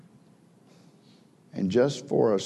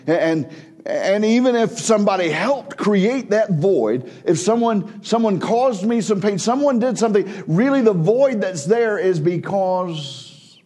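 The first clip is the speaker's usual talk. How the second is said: high, loud pulpit cry, pressing the question; preaching at full force; 10 semitones higher, 5 dB louder than usual. Acted. Preacher confronting the congregation over Peter's denial, fervent. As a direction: drive the point home to the congregation.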